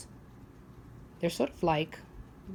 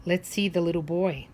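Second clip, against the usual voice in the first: ordinary delivery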